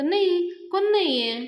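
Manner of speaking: high, loud, hurried cover-up; mock loud denial. Panya to Yasha, hiding who is there: loud mock denial. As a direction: bluff it out loudly.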